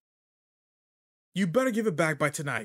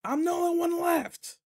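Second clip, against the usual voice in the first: high, loud, slow call across the field; drawn-out shout to a far-off teacher; 4 dB louder than usual. Acted. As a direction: yell it so the distant teacher hears. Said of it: "What was that, Teach?".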